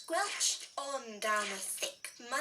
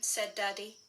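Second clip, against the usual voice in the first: plain reading voice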